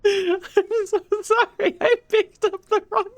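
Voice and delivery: squeaky, extremely high laughing voice; laughing apology. Real, not acted. Streamer sorry for grabbing the wrong Senzu, squeaking with laughter. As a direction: apologise through helpless high-pitched laughter.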